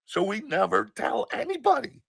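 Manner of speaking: high-pitched, insistent warning; upset mother. Retold or imitated. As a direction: imitate an upset mother laying down a rule. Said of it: "And she's upset".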